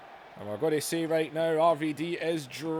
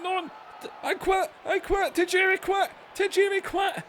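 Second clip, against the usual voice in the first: mock surrender; 11 semitones higher than usual. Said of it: "and he's saying".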